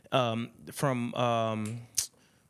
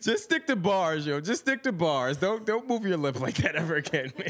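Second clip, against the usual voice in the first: playful teasing; 7 semitones higher than usual. Real, not acted. Playful teasing, high-pitched mock.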